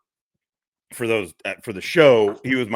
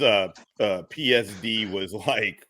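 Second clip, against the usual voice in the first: normal talking voice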